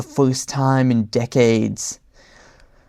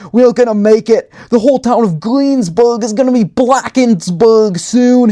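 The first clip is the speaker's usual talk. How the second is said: higher, louder frantic warning; panicked shout; 8 semitones higher, 5 dB louder than usual. A narrator's reading. Necktie urges his partner to find the book, shouting in panic. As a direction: keep the alarm going. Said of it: "Necktie screamed".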